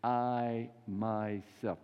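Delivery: I stretched very slowly and weighted; emphatic I myself. Retold or imitated. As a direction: lean hard on I, then myself, very slowly. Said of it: "it's even emphatic at the beginning".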